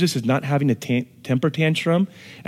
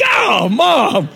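put-on kid's protest; 9 semitones higher, 5 dB louder than usual. Kid crying out at mom.

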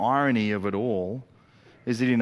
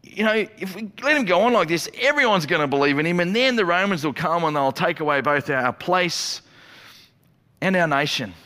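mildly acted alarm, higher pitch; mock-anxious warning, pitched up; fear-based; 7 semitones higher, 4 dB louder than usual. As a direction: play worried leaders, voice raised. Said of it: "it's fear-based".

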